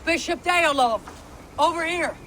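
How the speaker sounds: calling out. Acted. Calling the bishop over.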